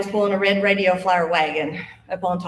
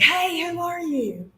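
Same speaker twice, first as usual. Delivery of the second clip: much higher, drawn-out call across the field; 11 semitones higher, slower than usual. Retold or imitated. Friend calling out to the strange children, high slow call. Friend calls to ghost kids.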